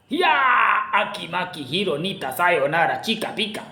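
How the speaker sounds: drawn-out "hiya", then slow nonsense chant; mock martial-arts cry. Fighter answers the beast's blows, mock martial-arts chant. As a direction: ham up a gibberish battle cry.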